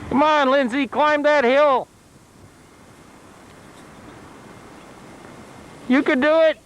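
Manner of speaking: cheering on. Real, not acted. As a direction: cheer her up the hill. Dad cheering daughter up hill.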